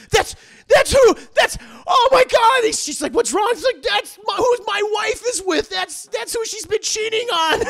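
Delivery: very high, loud panicked outburst; frantic shock. Retold or imitated. Bryce realizing who his wife cheats with, frantic. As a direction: reel at the betrayal, near tears.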